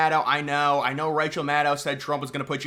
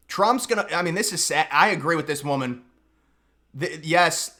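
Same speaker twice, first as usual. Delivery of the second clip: ordinary talk, nothing marked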